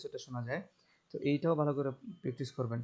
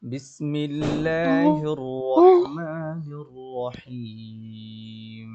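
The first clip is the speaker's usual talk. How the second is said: slow, drawn-out tajweed chanting; Quran recitation; 7 dB louder, much slower than usual. Shown as a demonstration. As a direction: recite with long melodic stretches. Teacher recites Quran slowly.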